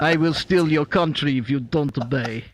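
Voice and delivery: put-on low, menacing Russian accent. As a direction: threaten like a Russian strongman, voice dropped. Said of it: "Get your Russian accent on".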